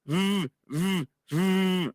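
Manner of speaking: v as a long buzz, lips on teeth, like a phone vibrating. Shown as a demonstration. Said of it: "así suena".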